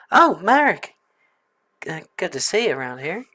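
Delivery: put-on lightly done Southern belle accent; a soft Southern belle welcome, lightly drawn; [name] tries a gentle Southern belle accent. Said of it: "Southern belle".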